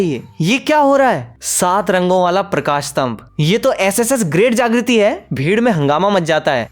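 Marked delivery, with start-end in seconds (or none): 0.0-1.4 high, startled cry; shocked shout. A narrator's reading. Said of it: "कोई चिल्लाता है". 3.4-5.3 amazed. A narrator's reading. Crowd stunned by light pillar.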